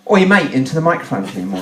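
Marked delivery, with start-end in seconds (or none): 0.1-0.5 loud, punchy call; short loud mock hail; demonstrated blast. Shown as a demonstration. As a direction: call it out to show how it goes. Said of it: "give them a blast of what it's like".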